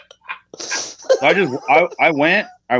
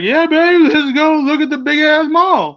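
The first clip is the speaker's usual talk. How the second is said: much higher, loud goofy voice for Mark; goofy excited buddy; 6 dB louder than usual. Retold or imitated. Wrestler mocking his partner's giddy mall plan in a high goofy voice. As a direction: squeal it like a giddy tourist. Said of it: "and Mark's like".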